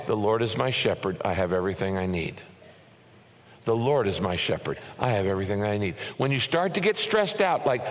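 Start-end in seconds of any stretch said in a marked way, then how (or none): 0.1-5.9 calm affirmation. Shown as a demonstration. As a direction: say it to yourself to find calm. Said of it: "you need to pause and say".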